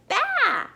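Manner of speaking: high falling baa; sheep bleat. A narrator's reading. Narrator voicing the sheep, high baa. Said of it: "Sheep said".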